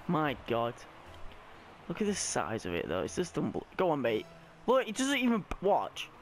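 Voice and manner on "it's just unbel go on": ordinary talk, nothing marked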